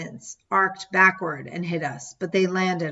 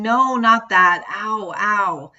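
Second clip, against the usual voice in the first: loud, slow frustrated protest; a child yelling, stamping her point; 3 semitones higher, 6 dB louder, slower than usual. Retold or imitated. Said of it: "I was yelling".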